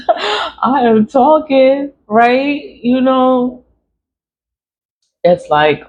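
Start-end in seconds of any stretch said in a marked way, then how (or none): 0.1-3.6 laughing through the words, slow and high. Real, not acted. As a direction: giggle it out, stretched and higher. Laughing about being alive.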